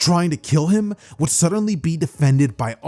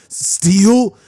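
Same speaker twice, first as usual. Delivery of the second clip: higher, louder startled question; shocked; 6 semitones higher, 6 dB louder than usual. Acted. Hero to his partner as the monster gets up, shocked. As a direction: call out in alarm. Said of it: "looking at that thing in shock".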